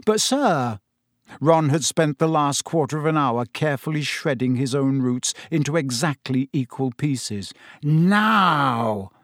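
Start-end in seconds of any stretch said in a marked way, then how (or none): 0.1-0.8 protesting. A narrator's reading. Ron protesting. 7.8-9.1 dangerous. A narrator's reading. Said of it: "in his most dangerous voice".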